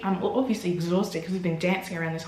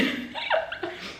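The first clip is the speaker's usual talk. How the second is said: high breathy laugh; 6 dB quieter than usual. Real, not acted. Laugh at own story.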